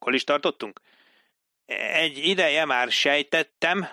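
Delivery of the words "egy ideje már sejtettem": "Egy ideje már sejtettem" read back higher and slowly, word by word. Acted. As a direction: read the line back carefully like a clerk. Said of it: "olvasta vissza Dilip a jelentést".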